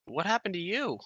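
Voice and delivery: high, soft character voice; softly voiced, high-pitched question; Oisin. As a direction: ask it softly with the pitch lifted well up. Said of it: "and Oisin says, uh".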